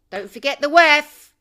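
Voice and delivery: loud shout of the name; sudden loud jab; outburst. Real, not acted. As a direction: yell it at the audience. Ranting burst about the WEF.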